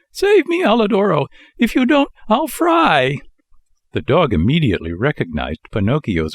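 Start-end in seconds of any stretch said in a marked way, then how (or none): 0.1-3.2 very high, desperate puppet plea; pitiful little voice. Acted. Pinocchio begs the dog to save him, very high and pitiful. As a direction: squeak out a plea for rescue. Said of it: "a pitiful little voice was heard saying".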